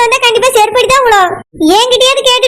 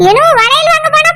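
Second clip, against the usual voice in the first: ordinary delivery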